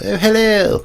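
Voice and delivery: very high, loud, hammed-up "Oh, hello!"; high falsetto mock Englishman greeting; posh British airman. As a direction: squeal it like a cartoon posh airman. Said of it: "the British airmen ... were all like".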